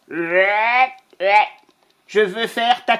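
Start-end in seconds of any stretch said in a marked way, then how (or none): 0.1-1.5 rolled lips brrrt of a pen scratching; playful pen-scratch brrrt; scribbling noise. Acted. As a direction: buzz the scribble again. Vulture writing noise.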